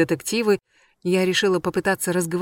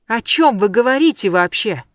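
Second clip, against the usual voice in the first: loud, higher exclamation; exclaiming; 4 semitones higher, 7 dB louder than usual. A narrator's reading. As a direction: cry out in disbelief. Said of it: "воскликнула в ответ".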